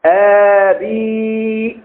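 آبي with a long drawn-out opening aa; long hamza. Shown as a demonstration. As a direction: stretch the first vowel of آبي very long. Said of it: "بالهمزة الممدودة".

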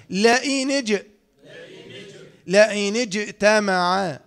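slow "لئن اجتمع" with a bouncing released j; measured tajweed recitation of "لئن اجتمع" with echoed j; qalqalah in recitation. Shown as a demonstration. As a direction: stretch "لئن اجتمع", popping the j. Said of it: "يعني ايه قلقلة".

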